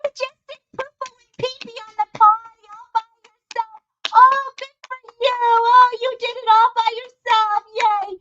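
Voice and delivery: high, quick, sing-song potty-training praise; hammed-up mommy baby talk; mock toddler praise. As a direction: gush as if to a proud little kid. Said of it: "like he's a four-year-old that just us".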